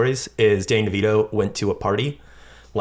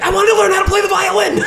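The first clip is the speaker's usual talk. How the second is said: much higher, loud, laughing scream; random yell; 7 dB louder than usual. Retold or imitated. Chunk threatened with a blender, desperate high yell. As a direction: shriek it in panic with a laugh. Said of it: "the most random thing to yell".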